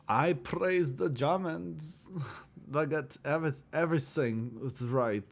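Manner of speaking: a mock German praising Germans, drawn out; German accent. Vlogger jokingly praising Germans in a German accent. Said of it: "That's my German accent for you".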